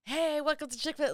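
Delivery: chirpy fast-food welcome, acted out; super enthusiastic. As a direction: imitate a super keen young server. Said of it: "like, super enthusiastic".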